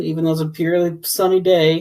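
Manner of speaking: normal talking voice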